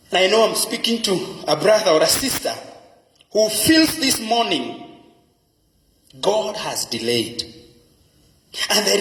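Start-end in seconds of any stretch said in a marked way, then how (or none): none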